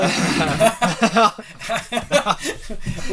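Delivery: laughing through the words; giggly, breaking into laughter. Real, not acted. Laughing at being dads.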